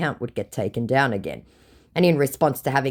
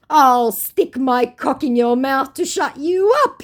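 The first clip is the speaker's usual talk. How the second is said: mocking scammer voice; 12 semitones higher, 6 dB louder, slower than usual. Reading the scammer's reply.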